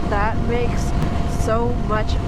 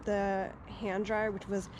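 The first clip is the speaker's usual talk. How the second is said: soft, quiet storytelling; speaking softly; hushed; 11 dB quieter than usual. Real, not acted.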